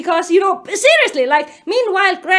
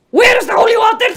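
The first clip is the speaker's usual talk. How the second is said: quick, raised, loud mock cry; shocked outburst; 5 semitones higher, 5 dB louder, faster than usual. Reader reacting to a racy scene, scandalized shout.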